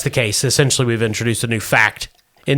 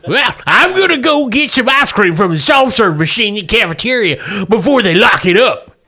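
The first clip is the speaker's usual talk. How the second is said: put-on old prospector; 8 semitones higher than usual. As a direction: lilt it like an old miner. Wilbur voiced by the GM.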